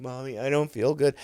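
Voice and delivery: usual speaking voice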